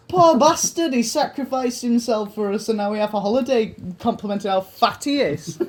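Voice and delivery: loud, high mock pity; a hint of mock pity for Jesus; mock sympathy. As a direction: pity him in jest, loud. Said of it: "That's what I'm thinking, like".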